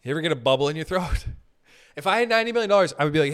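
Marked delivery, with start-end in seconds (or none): 2.0-2.9 excited fast repeat. Acted. Laughing, fast repeat of line.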